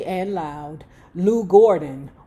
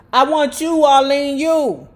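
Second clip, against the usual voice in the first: louder, pushy, pitched up; 5 semitones higher, 6 dB louder than usual. Retold or imitated. [name] picks his girl.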